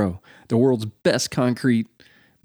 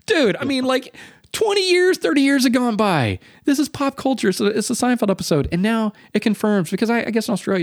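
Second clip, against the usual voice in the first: higher, worked-up telling; excited rant; 11 semitones higher than usual. Real, not acted. Telling his cohost about the coincidence, excited. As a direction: pile it on in excited disbelief.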